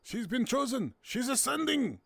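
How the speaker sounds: high-pitched dramatic cry; mock-epic proclamation. Announcing to the group that a player ascends, mock-awed high cry.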